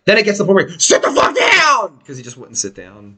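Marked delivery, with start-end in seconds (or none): 0.8-2.0 high, fed-up order, slowed down; fed-up yell. Retold or imitated. Boss snapping at drunk roadie, mock yell. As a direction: bark it out, patience gone. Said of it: "And then I'm like".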